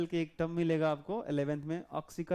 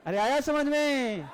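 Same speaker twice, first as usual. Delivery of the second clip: loud, high, drawn-out shout to the class; calling out; 7 semitones higher, 6 dB louder, slower than usual. Real, not acted. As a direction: demand a response, stretching the question. Teacher calls out for a response. (ज़ोर से खींचकर पुकारते हुए)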